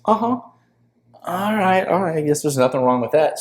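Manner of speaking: high, teasing echo of his own excuse; a hammed-up spirit playfully mimicking him; mock high ayahuasca voice. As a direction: parrot his words back in a high mocking voice. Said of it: "And she goes".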